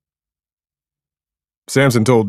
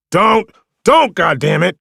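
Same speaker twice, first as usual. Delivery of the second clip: desperate high yell, much louder; 12 semitones higher, 10 dB louder than usual. A narrator's reading. Diggs yelling at Cross to stop, desperate shout. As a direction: yell it to stop him shooting. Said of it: "Diggs yelled".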